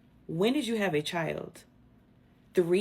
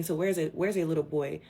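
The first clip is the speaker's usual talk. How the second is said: ordinary talk, nothing marked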